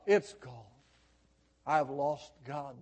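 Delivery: dropped low and soft; desolate hush. Acted. Preacher voices a backslider confessing, in low desolation. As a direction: let the voice sink into defeat. The confession collapses after the outcry.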